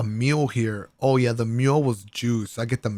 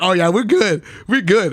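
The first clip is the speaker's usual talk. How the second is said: loud, higher gleeful we good; excited; 7 semitones higher, 5 dB louder than usual. Retold or imitated. Friend reacting to the giant funnel cake, excited and loud. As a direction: celebrate it, pumped.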